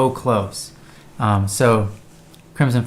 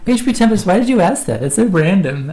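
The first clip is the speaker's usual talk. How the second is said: higher, louder startled reading of a name; higher, louder puzzled surprise; surprised; 9 semitones higher, 6 dB louder than usual. Real, not acted. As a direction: caught off guard by a strange question. Surprised by an odd question.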